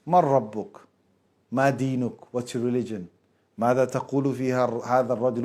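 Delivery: low, soft interrogating angel; grave angel questioning. Retold or imitated. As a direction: question the dead from the dark, low and quiet.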